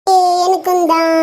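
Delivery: lightly voiced excited child. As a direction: chime in like a kid. Child chimes in.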